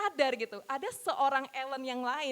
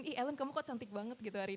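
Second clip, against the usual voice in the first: playful self-flattery; 8 dB quieter, faster than usual. Retold or imitated. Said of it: "saya ngomong ke cermin".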